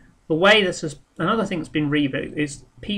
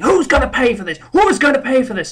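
a higher, louder mock of her indignant demand; hammed-up outraged nurse, higher and louder; storming demand; 10 semitones higher, 8 dB louder, faster than usual. Nurse storming back at staff, loud and indignant.

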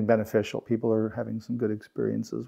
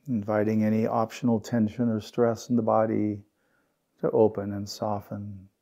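unhurried meditation guide, long pauses between phrases; guided meditation. Real, not acted. Teacher guiding the group through belly breaths and settling, guided meditation.

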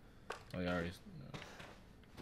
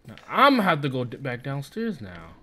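angry rant; 4 semitones higher, 6 dB louder than usual. Real, not acted. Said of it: "Oh, I'm pissed off".

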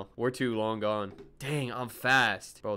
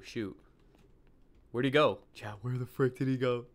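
ordinary delivery

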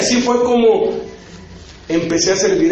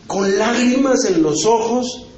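normal talking voice

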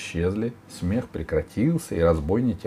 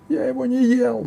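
high, loud denial; ogre's shout; 6 dB louder than usual. A narrator's reading. Ogre denying the crime to Sila, high shout. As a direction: cry out high as the accused ogre. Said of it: "закричал людоед".

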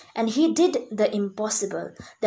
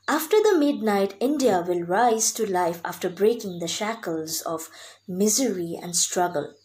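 ordinary talk

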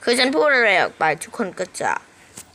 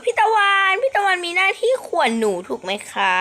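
put-on much higher, childish viewer voice; imitating a viewer; 9 semitones higher than usual. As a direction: ask like a young fan. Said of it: "บางคนอาจจะถามว่า". (แกล้งทำเสียงผู้ชมเด็กถาม)

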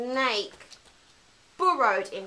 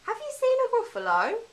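squeaky mouse question, much higher and fast; high quick mouse voice; 13 semitones higher, much faster than usual. Acted. Mouse asking the snake, high squeaky and quick. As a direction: zip it out high like a tiny mouse.

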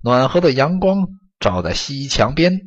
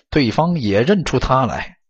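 ordinary delivery

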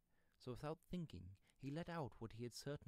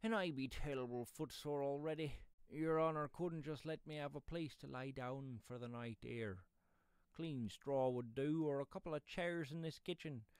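higher, louder pleading rustic; wheedling pedlar; 5 semitones higher, 8 dB louder than usual. Acted. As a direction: plead your case like a footsore pedlar.

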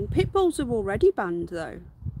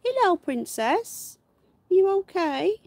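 cooing to rabbit; 10 semitones higher, 5 dB louder than usual. Real, not acted. Owner greeting her rabbit in high cooing baby talk. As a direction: coo up high at the bunny.

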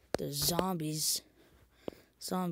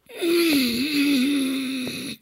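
loud rasping zombie growl; zombie noise; 10 semitones higher, 15 dB louder than usual. Shown as a demonstration. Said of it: "That's a zombie noise".

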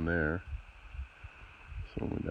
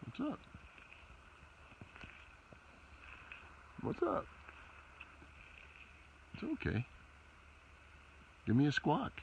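much higher, cooing at a bird; talking to a bird; 12 semitones higher, 9 dB quieter than usual. Real, not acted. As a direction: coo like chatting to a pet. Talking to magpie on truck.

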